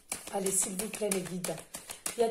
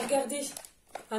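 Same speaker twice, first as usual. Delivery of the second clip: usual speaking voice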